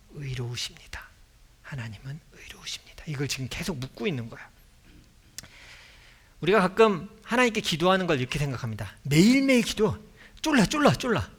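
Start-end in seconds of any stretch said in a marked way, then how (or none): 0.1-3.0 whispered prayer. Retold or imitated. Humble repeated prayer. 9.0-11.3 mock nagging. Mocking nagging prayer.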